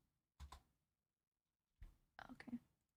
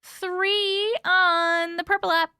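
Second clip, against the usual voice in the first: excited announcement; 6 semitones higher, 4 dB louder, slower than usual. Real, not acted. Announcing poll results.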